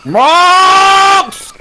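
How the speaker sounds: loud high call across the room. Real, not acted. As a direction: shout the name to get attention. Calling out a name.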